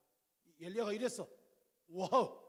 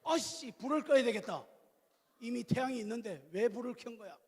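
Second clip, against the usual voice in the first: hammed-up mock complaint; 3 semitones higher, 7 dB louder than usual. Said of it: "막 이렇게".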